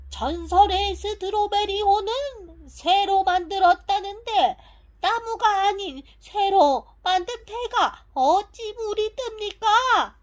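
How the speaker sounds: 높고 큰 소리로 의아해하며; 믿기지 않는다는 듯 목청 높여 묻기; 극적인 반문. Acted. 극적으로 의문을 던지는 도입부. (큰 목소리로 따지듯 묻는 말투)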